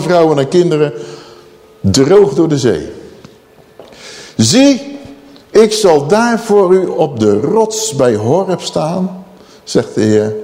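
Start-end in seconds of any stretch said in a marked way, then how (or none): 4.4-9.2 slow divine declaration opening on a high 'Zie'; solemn proclamation. Acted. God promising Moses, solemn proclamation. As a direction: proclaim it with measured weight.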